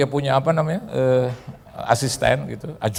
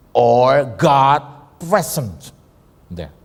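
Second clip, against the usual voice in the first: each word drawn out slowly, a little louder; very slow emphasis; 2 semitones higher, 4 dB louder, much slower than usual. Acted. Preacher drawing out God dwelling among worshippers, very slow. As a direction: lay out every word slowly and deliberately.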